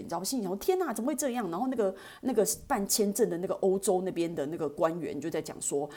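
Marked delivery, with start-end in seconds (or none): 0.6-1.5 puzzled. Retold or imitated. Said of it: "一头雾水".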